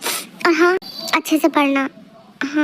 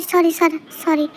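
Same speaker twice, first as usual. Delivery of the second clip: normal talking voice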